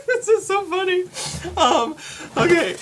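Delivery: high, loud, laughing words; cracking up mid-story; real laughing. Real, not acted. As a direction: talk through a fit of giggles. Cracks up at their own story.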